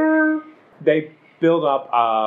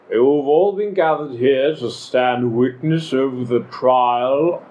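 Lorne; slower than usual. Acted. An elder opening a trial before the gathered tribe, slow solemn proclamation. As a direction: proclaim it formally, stretching the words. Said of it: "and Lorne says".

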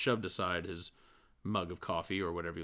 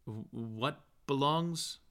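slow, stammered higher question; puzzled query; 5 semitones higher, slower than usual. A narrator's reading. Bloom confused asks Stephen, slow stammer. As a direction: lean in confused. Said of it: "queried Mister Bloom".

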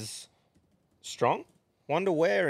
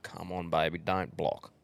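low, soft private plea; hushed coaxing; 5 semitones lower, 6 dB quieter than usual. Acted. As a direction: gently coax it under your voice. Acting out coaxing the toilet.

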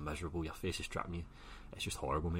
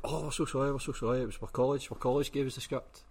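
mock apology; 9 semitones higher, 5 dB louder than usual. The guys apologising, voiced by him.

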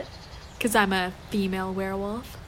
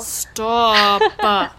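drawn-out, louder protest; loud annoyed stop; 7 dB louder than usual. Acted. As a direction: shout it out, fed up with the teasing. Annoyed at teasing.

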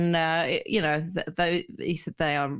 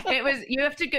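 normal talking voice

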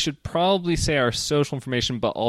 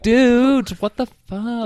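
much higher and louder, an indignant yelp; outraged disbelief; 12 semitones higher, 6 dB louder, slower than usual. Retold or imitated. Retelling to co-host her reaction to a podcast spoiler, shocked indignant yell. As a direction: throw up your hands at the spoiler.

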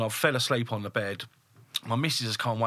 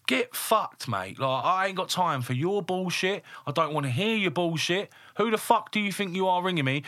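higher-pitched, indignant retold rant; raging; 8 semitones higher than usual. Boss fed up with worker's late-night calls, raging. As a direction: tell the caller off in exasperated, raised pitch. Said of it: "Like, I was raging".

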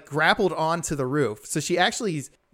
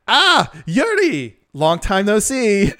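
high, loud and slow, a grand reunion line; milk the surprise reunion like an anime hero; dramatic greeting; 6 semitones higher, 6 dB louder, slower than usual. Retold or imitated. Character greets old partner dramatically.

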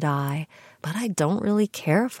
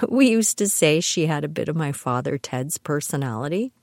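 normal talking voice

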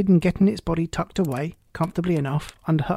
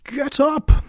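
high, piercing royal command; shrill, loud; 10 semitones higher, 5 dB louder than usual. A narrator's reading. Queen orders gardeners up, shrill and loud. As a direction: shout it shrilly at cowering subjects. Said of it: "in a shrill, loud voice".

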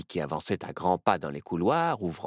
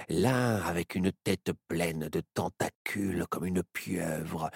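ordinary delivery

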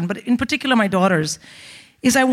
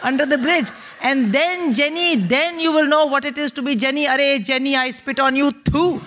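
put-on high-pitched vengeful tirade, Indian accent; ranting Indian mother; 5 semitones higher than usual. Mother cursing the recruiter Jenny, gleefully furious. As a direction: escalate into a triumphant mom's curse.

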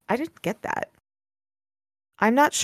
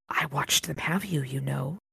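much softer, low, creeping whisper; 6 semitones lower, 11 dB quieter than usual. Acted. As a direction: breathe it like a lurking thing in the dark. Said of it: "whispered one of the voices".

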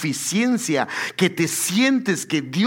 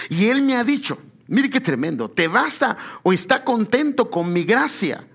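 impassioned sermon climax; fervent preaching; 5 semitones higher than usual. Acted. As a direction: drive the point home to the congregation.

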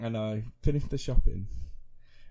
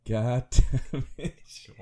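mock exasperated groan; 3 semitones higher, 6 dB louder, much slower than usual. Mock frustration at friend.

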